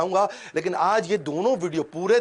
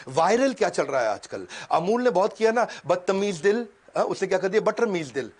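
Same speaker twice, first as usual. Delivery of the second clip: normal talking voice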